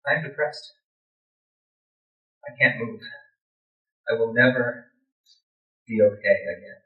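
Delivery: low, soft, halting despair; depressed. Retold or imitated. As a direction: speak softly and heavily, with long pauses. Recounts hopeless depression.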